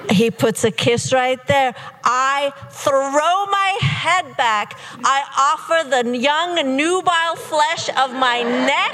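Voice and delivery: giddy, melodramatic storytelling rising in pitch; mounting swoon. Retold or imitated. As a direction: stretch the words as the thrill mounts. Mock-swooning build of the kisses.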